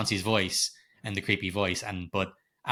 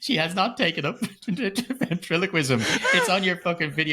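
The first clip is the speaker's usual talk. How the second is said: laughing; 9 semitones higher, 3 dB louder than usual. Real, not acted. Laughing while ranting.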